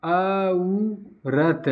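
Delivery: अ, उ, रत with औ broken into अ plus उ; अ, उ, रत, each vowel separate; aurat split as a-u. Shown as a demonstration. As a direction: spell out अ, उ, रत slowly. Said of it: "औ का उच्चारण होगा".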